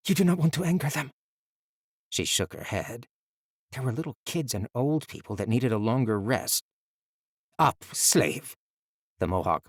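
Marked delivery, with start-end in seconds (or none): 0.1-1.1 high, fast urgent warning; urgent boy. A narrator's reading. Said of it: "he urged". 7.6-8.6 louder, harsh guard order; harsh command. A narrator's reading.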